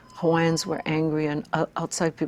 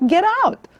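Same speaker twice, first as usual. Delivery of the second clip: "get out" flung much higher and louder than the earlier ones; 7 dB louder than usual. Retold or imitated. Shouting "get out" at critics at the peak of the rant. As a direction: throw "get out" up high and loud at the peak.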